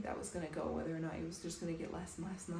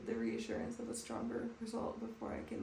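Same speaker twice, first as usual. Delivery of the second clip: normal talking voice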